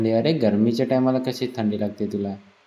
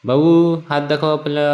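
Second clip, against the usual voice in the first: calling out; 5 semitones higher, 4 dB louder than usual. Real, not acted. Calling to child in pool.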